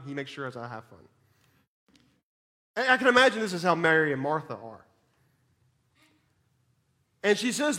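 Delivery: ordinary delivery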